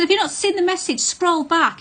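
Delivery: high, loud, upset demand; exasperated outcry. Retold or imitated. Upset woman scolding her partner, high and loud. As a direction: she is pleading with him to finally read it.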